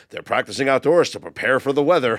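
loud, punchy news anchor read; newscaster voice. Acted. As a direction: announce it like breaking TV news. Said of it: "was that your newscaster voice".

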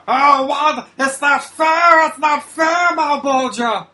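high, loud, slow overacted wail; hammy stage actor. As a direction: overact the whining villain. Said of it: "In my overacting way".

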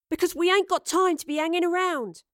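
high, impatient outburst; said loudly. A narrator's reading. Astrid tells the commander there is no time, loudly. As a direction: blurt it out to hurry them along. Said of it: "Astrid said loudly".

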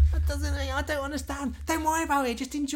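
high, put-on confused voice; mock confused viewer. Mocking a confused moviegoer, high put-on voice.

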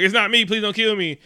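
high, loud, hammed-up begging; mock panicked plea. Henchman begging Idris not to shoot, mock panicked plea. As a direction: plead for your life, played for laughs. Retold man begging under gunfire.

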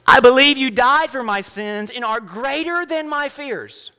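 slow, high, measured prayer for repeating; led prayer. Acted. Pastor leading the congregation in prayer, slow and measured. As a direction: lead the room line by line in prayer.

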